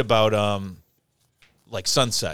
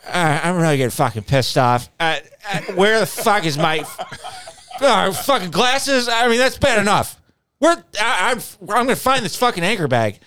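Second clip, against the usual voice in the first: high, loud angry rant; imitating Corey; 8 semitones higher, 5 dB louder than usual. Corey on the riverbank, fuming about glasses and bag, high angry rant. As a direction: rant like a man about to snap. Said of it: "Corey ranting".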